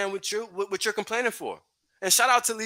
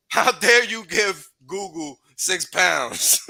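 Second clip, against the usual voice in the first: slow, hammed-up indignant "how dare you", much louder; mock outrage; 5 dB louder, much slower than usual. Streamer mocking complainers with slow, loud fake outrage. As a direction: drag it out like a scandalised scold.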